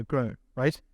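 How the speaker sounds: normal talking voice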